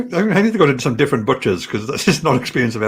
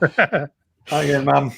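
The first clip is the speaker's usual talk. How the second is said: slow put-on greeting; much slower than usual.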